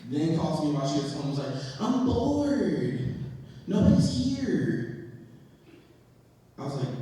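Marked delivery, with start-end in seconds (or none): 1.8-5.1 higher, drawn-out bored whine; mock whining. Imitates girlfriend complaining on phone.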